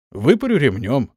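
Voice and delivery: plain reading voice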